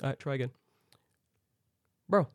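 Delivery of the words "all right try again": lightly voiced older worker, softly resigned; unhurried older worker. Older gate worker sending a passenger back, soft and unbothered. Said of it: "One old guy was".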